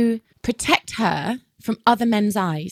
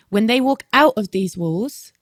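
ordinary talk

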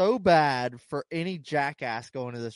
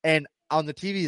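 normal talking voice

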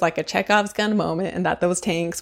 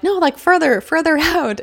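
higher, louder mock directing; waving someone further away, pitched way up; imitated urging; 10 semitones higher, 4 dB louder than usual. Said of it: "when Hooper is like".

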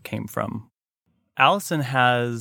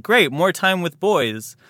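higher, louder, slow mock-cheerful dad; lightly voiced father pleased to be rid of her; a hint of a gleeful dad; imitating the dad; 6 semitones higher, 5 dB louder, slower than usual. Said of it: "now he's like".

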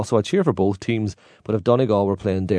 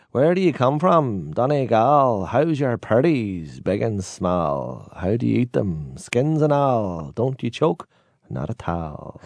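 slow sing-song school recitation; recited school poem; 2 semitones higher, much slower than usual. Retold or imitated. Reciting the childhood potato poem, slow sing-song. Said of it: "So the poem was".

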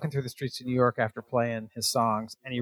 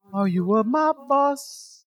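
high, slow celebrity impression; drawn-out, high-pitched character voice, half laughing; James Gandolfini; 9 semitones higher, 4 dB louder, slower than usual. Host imagines Gandolfini as the James of the song, celebrity impression. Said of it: "It's James Gandolfini".

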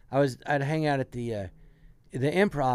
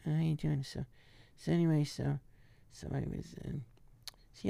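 much softer, mumbled; deadpan Sandler mumble; barely audible Adam; 8 dB quieter than usual. Retold or imitated. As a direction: throw it away under the breath. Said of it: "you can barely hear the guy".

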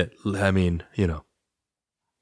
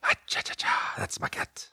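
whisper; 12 dB quieter than usual. Real, not acted. Cat burped, hushed aside.

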